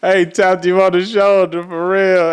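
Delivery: high, loud and slow, coming out of a laugh; amused, laughing. Real, not acted. Recalling the shoulder tap to his cousin, really amused and laughing. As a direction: say it through the tail end of a big laugh. Still cracking up after laugh.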